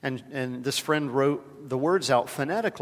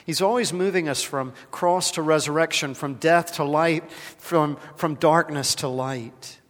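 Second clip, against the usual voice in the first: preaching with force; 2 semitones higher, 6 dB louder than usual. Acted. Preacher proclaiming hope to the congregation, louder and building.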